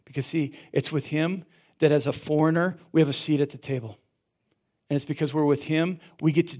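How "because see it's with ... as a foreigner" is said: ordinary talk, nothing marked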